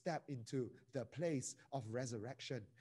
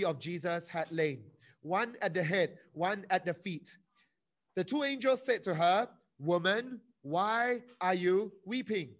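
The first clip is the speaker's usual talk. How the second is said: scripture reading; 3 semitones higher, 8 dB louder than usual. Acted. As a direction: proclaim the verses to the room. Reads scripture aloud.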